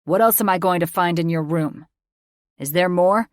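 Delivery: loud, hard demanding voice; angry interrogation. A narrator's reading. Father interrogates daughter about her room, angry.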